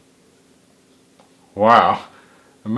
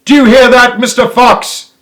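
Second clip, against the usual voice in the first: much higher, louder shout; yell down the hole; 11 semitones higher, 9 dB louder than usual. A narrator's reading. Bean bends low and yells at the fox, much higher and louder. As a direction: bellow into the hole. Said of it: "yelled Bean, bending low and shouting".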